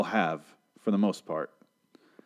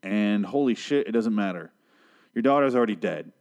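usual speaking voice

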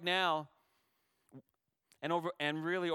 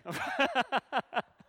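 a high, tearful laugh that breaks out; teary laugh; 11 semitones higher, 8 dB louder than usual. Real, not acted. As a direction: laugh through the tears. Laughs after admitting he is crying.

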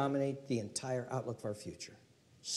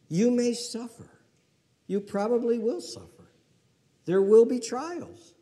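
higher, louder solemn warning; 10 semitones higher, 10 dB louder than usual. Acted. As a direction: lift the voice to warn them. Preacher warns of suffering.

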